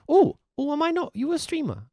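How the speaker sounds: sudden surprised realization. Real, not acted. Surprised discovering viewer streams.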